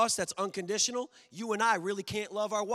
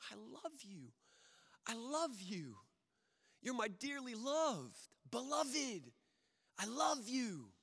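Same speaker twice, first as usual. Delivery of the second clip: soft, hushed, tender, higher; tender voice of God; 4 semitones higher, 8 dB quieter than usual. Acted. God telling his beloved people he loves them, soft tender voice. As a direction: whisper love over them gently.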